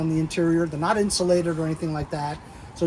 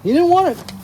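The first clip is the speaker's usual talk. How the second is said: high squeaky voice; 12 semitones higher, 8 dB louder than usual. Pretend. High squeaky aside about John.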